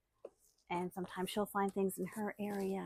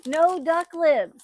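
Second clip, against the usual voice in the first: loud, slow, higher mock command; mock stern order; 6 semitones higher, 13 dB louder, much slower than usual. Real, not acted. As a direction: drag it out like a playful scolding. Scolding herself on camera.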